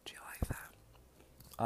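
whispered. Real, not acted. Eating on camera, whispered aside.

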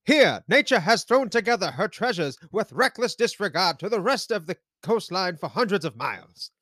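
put-on high, loud old-time newscaster. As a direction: read it like a grand newscaster. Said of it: "you've got the newscaster voice for it".

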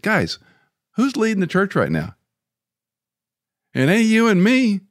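mock confrontation. Confronting elders in retold meeting.

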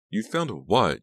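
high, loud, incredulous question; amazed exclamation; astonished cry. A narrator's reading. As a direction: cry out in disbelief, pitch swooping. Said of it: "Jade cried".

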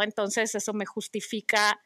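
ordinary delivery

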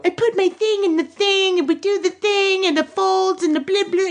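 put-on high singsong kid voice; mock twelve-year-old writer. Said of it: "clearly written by a twelve-year-old".

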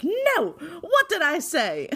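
sharp high mock scold; scolding Simmons. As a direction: play the strict doctor catching her patient. Simmons scolding Skye, retold.

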